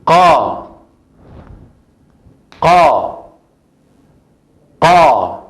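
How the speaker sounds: sílaba qa repetida; syllable drill. Shown as a demonstration. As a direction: repeat qa clearly. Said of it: "Tiene fatha".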